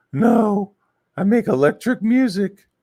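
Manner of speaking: high falsetto mocking impression; loud, silly high voice; Nicholas. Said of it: "he sounds like that".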